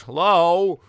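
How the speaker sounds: hesitant caller with high, loud openings; hammed-up awkward phone-guy voicemail; Scott phone message. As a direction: swing from high bursts to stammering asides. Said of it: "Scott message".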